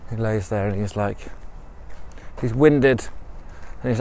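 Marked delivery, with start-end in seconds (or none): none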